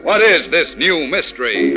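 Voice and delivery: ordinary talk, nothing marked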